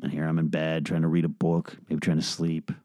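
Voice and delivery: low and soft, drained. Real, not acted. Comedian in bed kept awake by beeps, low and soft. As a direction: sink into a weary murmur.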